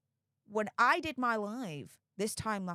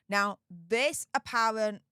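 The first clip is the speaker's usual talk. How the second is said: normal talking voice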